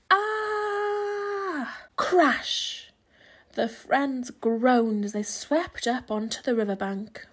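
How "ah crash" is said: sustained high scream then crash; long high scream. A narrator's reading. Animals swept away as the house falls, screaming.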